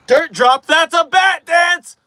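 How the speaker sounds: high, loud slow shout; excited shout. Real, not acted. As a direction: shout the result.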